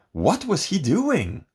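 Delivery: plain reading voice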